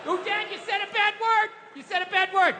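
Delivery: put-on high little-girl voice, tattling; imitating his daughter. Daughter catching dad swearing, in a high kid voice. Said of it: "she does all of that shit".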